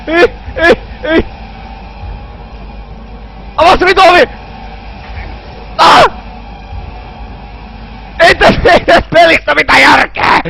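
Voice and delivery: high, loud frantic yells; panicked screaming. Real, not acted. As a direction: scream while running away. Screaming while chased.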